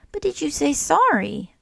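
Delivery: lightly voiced Snail, asking kindly, last word rising high; gentle Snail. A narrator's reading. Snail presses the question.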